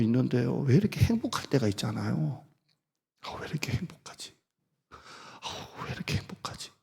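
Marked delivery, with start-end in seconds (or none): 3.2-5.5 whispered thought. Retold or imitated. Happy private thought at home. 5.9-6.7 왜 이렇게 행복하지 breathed out as a whisper, no voice. Retold or imitated. Pastor recalls a happy moment at home, whispering. Retold happy inner thought.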